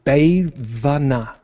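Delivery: Ayubhayavana said slowly, syllable by syllable; butchered Sinhala. Shown as a demonstration. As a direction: Ayubhayavana sounded out with effort. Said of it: "I've completely butchered it".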